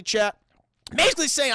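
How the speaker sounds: ordinary delivery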